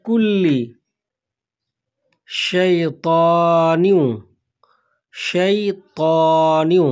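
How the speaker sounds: শাইতানিউ with a clear শ sound, drawn out slowly; slow Arabic recitation. Shown as a demonstration. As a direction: recite slowly, শিন in শাইতানিউ not সিন. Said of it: "এখানে শিন রয়েছে, সিন না". (শিন দিয়ে ধীরে শাইতানিউ)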